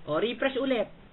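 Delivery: ordinary talk, nothing marked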